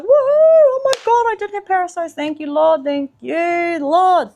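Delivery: loud, high, joyful thanks; celebrating. Retold or imitated. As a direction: shout for joy to the Lord.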